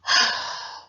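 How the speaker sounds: long unvoiced sigh out; heavy sigh. Shown as a demonstration. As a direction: let out a big tired sigh.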